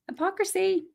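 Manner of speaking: sarcastic lilt on Hypocrisy; mock disbelief.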